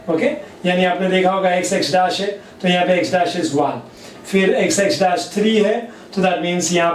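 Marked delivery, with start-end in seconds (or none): none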